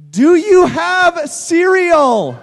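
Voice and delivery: drawn-out, loud, overly clear question to a foreigner; slow loud over-enunciation. Retold or imitated. Teammate mimicking Mark asking the store clerk, slow and loud. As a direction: stretch every word out loud like he can't understand English. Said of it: "Mark Clark takes three seconds and immediately says".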